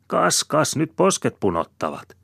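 high, loud needling; mocking taunt. A narrator's reading. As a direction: jeer at him in a high voice. Woman taunts angry man.

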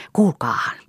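whispered, leaning in to share a secret; hushed whisper. A narrator's reading. Liisa whispering to her listeners before her tale. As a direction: draw them close with a whisper.

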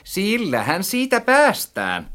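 high, spiteful echo; nasty mockery. A narrator's reading. Narrator echoes friend's words back, maliciously. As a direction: parrot it back meanly, pitched high. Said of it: "sanoin entistä häijymmin".

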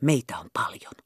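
urgent stage whisper; hissing whisper. Acted. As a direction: hiss the secret into her ear. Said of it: "kovaäänisesti sähisten kuiskasi".

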